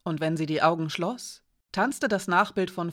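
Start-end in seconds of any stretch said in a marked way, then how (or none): none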